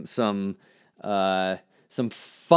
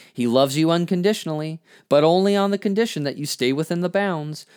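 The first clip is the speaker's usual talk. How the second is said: higher-pitched, emphatic spoken-word warning; poem recitation, warning; 6 semitones higher, 3 dB louder than usual. Acted. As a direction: lift the voice like a zealot cautioning the flock.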